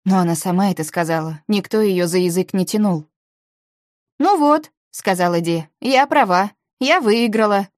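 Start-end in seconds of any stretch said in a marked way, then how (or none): 4.2-4.7 high-pitched note of vindication; triumphant. A narrator's reading. Ди is proven right about the calls, triumphant. Ди wins the argument. 5.8-7.7 high, raised-voice claim of victory; triumphant. A narrator's reading. Ди tells the others she won, triumphant.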